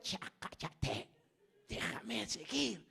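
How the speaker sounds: slow, strained protest; irritated reader. Retold or imitated. Poked reader wants to keep going, slow irritated protest. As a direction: drag out an annoyed protest.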